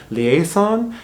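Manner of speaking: "liaison" said the French way, lee-ay-ZON with a nasal end; a careful French "liaison"; French word liaison. Shown as a demonstration. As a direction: put on a French pronunciation. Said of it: "something called".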